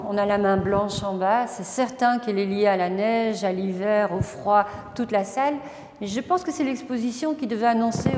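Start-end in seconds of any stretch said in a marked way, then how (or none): none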